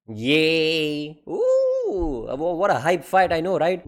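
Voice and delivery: mock hype, climbing into a high ooh; sarcastic cheering. Fan mocking a predictable fight, sarcastic cheer. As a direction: fake a crowd's excitement.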